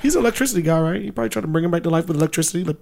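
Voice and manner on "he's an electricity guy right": ordinary talk, nothing marked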